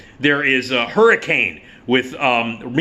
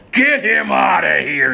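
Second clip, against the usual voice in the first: slow, louder mock bark of an order; imitating a general's shout; 4 semitones higher, 4 dB louder, slower than usual. General ordering someone removed, hammed-up shout. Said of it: "He does a lot of screaming".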